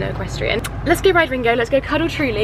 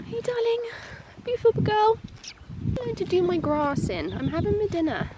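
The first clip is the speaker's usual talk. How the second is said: much higher cooing pet voice; 10 semitones higher, 5 dB quieter than usual. Real, not acted. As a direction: sweet-talk the grazing pony. Cooing, then voicing the pony.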